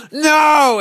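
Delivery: high loud panicked no; fearful refusal. Acted. Terrified man refuses Courage's help. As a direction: shout no and break free.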